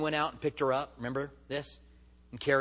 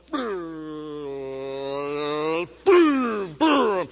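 booming footfall sounds, the last two much louder; imitated slow-motion thuds; 5 dB louder, much slower than usual. Runner falling in the movie, loud booming footsteps.

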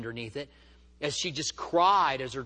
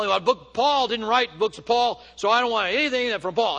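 mock the skeptic's stubborn pushback; contrary retort; 5 semitones higher, 6 dB louder than usual. Neighbor dismissing the preacher's verses, loud scoffing. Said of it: "It was just very contrary".

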